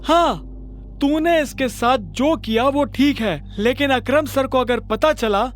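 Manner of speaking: scolding manager. Acted. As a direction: tear into the clerk. Manager threatening Pranav.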